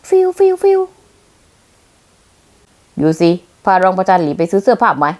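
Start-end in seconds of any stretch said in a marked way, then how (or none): 0.1-0.8 whoosh noises. Acted. Imitated whooshing.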